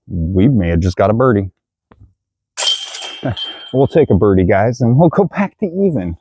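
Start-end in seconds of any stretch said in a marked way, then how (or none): 2.6-3.5 soft breathy laugh. Real, not acted. Laughs at surprise birdie.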